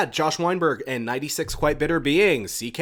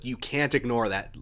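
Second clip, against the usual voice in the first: ordinary talk, nothing marked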